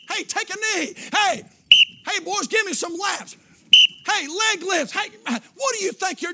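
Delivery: high, loud drill-coach barks at players; coach yelling. Retold or imitated. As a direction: bark at the team like a football coach.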